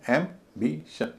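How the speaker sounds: "Ambition" very slowly in three parts, em, bi, shun; slow, segmented "ambition" with a pause after each syllable. Shown as a demonstration. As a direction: break "ambition" into separate syllables. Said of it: "तीन syllable है".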